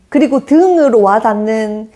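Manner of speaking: ordinary talk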